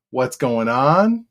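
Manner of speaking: ordinary delivery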